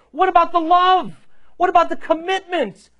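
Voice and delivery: high, loud acted outburst; indignant exclaiming. Soon-to-be wife demands love and commitment from groom, indignant. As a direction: cry out each question, outraged, voice way up. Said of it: "wife is indignant." She exclaims".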